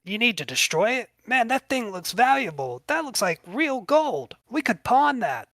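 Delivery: high, eager voice, eyeing the gold; a small-time thief lights up at loot; greedy Kickin' John. Acted. Thief tells his friend they could pawn the ring, in a high greedy voice.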